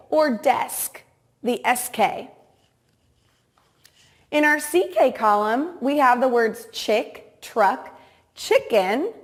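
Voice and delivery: ordinary delivery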